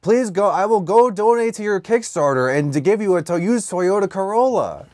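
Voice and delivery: high, swooping put-on voice; exaggerated sing-song, pitched high; mock pleading character. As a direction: plead in hammed-up broken English. Continues mock character bit.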